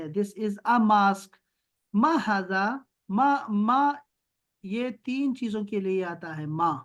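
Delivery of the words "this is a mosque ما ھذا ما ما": "This is a mosque" said very slowly; slow teaching read. Shown as a demonstration. Teacher modelling a sentence slowly for the child. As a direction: stretch every word for a beginner. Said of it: "تو یہ جب یہ آئے گا".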